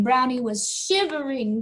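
ordinary delivery, nothing marked

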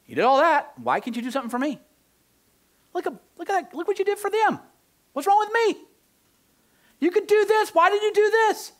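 high-pitched, mounting outcry; acted-out pleading protest, climbing to a loud, very high peak; protesting complaint. Believer complaining to Jesus about being passed over, protesting. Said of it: "I do this with Jesus".

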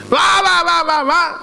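high-pitched mock wail; a loud, high "wah-wah" noise; imitated wailing. Mimics noise within ghost story.

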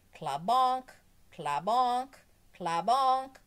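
loud, high clanking bell noise; imitated cowbell. A narrator's reading. Teacher keeps ringing her cowbell, loud clanking sound. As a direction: clang each one out with a punched ending.

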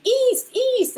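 high called name across a store; calling out. Retold or imitated. Old client calling out to him in a bookstore, high call. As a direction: call his name from a distance.

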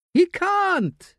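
high, strained frail voice; a dying man forcing words out; frail old man. A narrator's reading.

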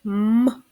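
М held as a lone hum; М as a single hard consonant, no letter name; isolated sound. Shown as a demonstration. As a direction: sound out М by itself. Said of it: "Перший звук".

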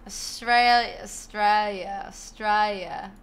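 slow, stretched Australian accent attempt. Shown as a demonstration. Streamer asked for Australian accent, trying it shakily on one word. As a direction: have a rough go at an Australian accent. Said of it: "Do the Australian accent?".

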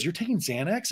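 soft, acted question with a rising end; restrained imitated query. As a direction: ask it in mild mock disbelief. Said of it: "Andy goes".

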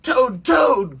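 high, loud calling of a name; crying out. A narrator's reading. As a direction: call out urgently to wake someone. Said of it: "cried Frog".

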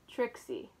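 a quick startled gasp; sharp gasp. Acted. Reading to preschoolers, gasping at the surprise. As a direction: suck in air in surprise.

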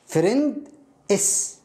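فريند, a break, then إس as the letter name "ess"; imitated split reading. Teacher showing how students read the plural, split apart. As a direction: pronounce إس separately after فريند, as a spelled letter. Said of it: "يقرأ الإس كأنه إس".

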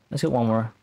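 usual speaking voice

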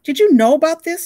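high, loud angry demand. A narrator's reading. Tuna angrily demanding an answer from Wahoo. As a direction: confront him. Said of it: "Tuna demanded of Wahoo".

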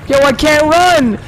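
terrified wail at a monster; panicked shouting. Real, not acted. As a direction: shriek it in full panic.

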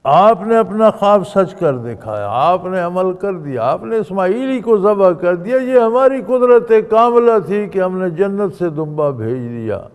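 ringing preacher's proclamation; loud proclamation. Retold or imitated. God proclaiming aloud to Ibrahim.

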